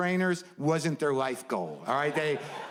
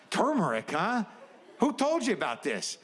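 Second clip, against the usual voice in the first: suspicious; 6 semitones higher than usual. Retold or imitated. Suspicious self-dialogue.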